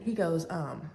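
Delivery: ordinary talk, nothing marked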